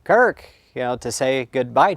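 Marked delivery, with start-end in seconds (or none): 0.0-0.4 loud, high repeated call; retold yelling. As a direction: imitate a dad calling out for his son. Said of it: "yelling to me, saying".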